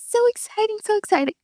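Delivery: high, soft gushing so exciting; giddy excitement. Real, not acted. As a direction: squeal it out quietly. Gushing about gem show.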